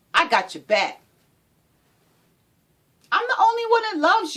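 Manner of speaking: high, loud, pushy partner; manipulative abuser. Acted. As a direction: bear down on her like a controlling lover. Voicing a controlling abuser.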